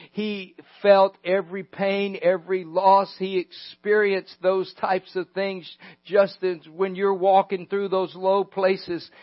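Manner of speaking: ordinary talk, nothing marked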